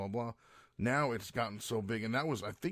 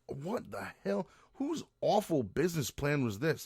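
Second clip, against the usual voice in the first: exasperated; 6 semitones higher than usual. Real, not acted. Rant about do-it-yourself stores.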